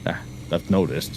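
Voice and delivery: ordinary talk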